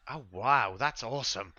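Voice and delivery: lightly voiced wowed little boy; a hint of childlike wonder; amazed boy. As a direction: marvel like an impressed kid. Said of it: "the boy's like".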